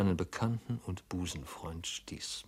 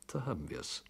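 murmured; 8 dB quieter than usual. Acted. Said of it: "murmelte unser Held".

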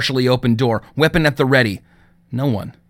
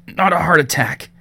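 strangled voice; 5 semitones higher than usual. Acted. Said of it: "he managed to croak between gasps".

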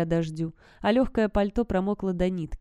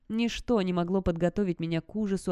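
ordinary delivery, nothing marked

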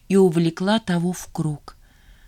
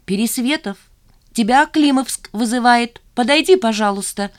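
loud, higher call from the corridor; raised, carrying summons; 6 semitones higher, 5 dB louder than usual. A narrator's reading. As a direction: shout for a colleague down the hall. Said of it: "крикнул кто-то из коридора".

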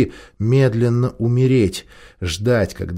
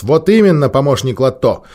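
loud, authoritative, higher pitch; commanding tone; 7 semitones higher, 7 dB louder than usual. A narrator's reading. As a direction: take charge and cut her off. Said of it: "Он властным тоном перебил".

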